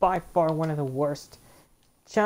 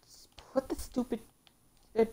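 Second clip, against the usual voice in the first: strained exasperated whisper; 7 semitones higher, 4 dB quieter, slower than usual. Real, not acted. Frustrated muttering at game.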